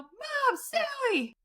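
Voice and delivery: very high, thin mock yell; retold yell. Sleeper yelling the wrong name for help, high mock yell. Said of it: "I open my mouth and I yell".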